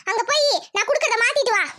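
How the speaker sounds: normal talking voice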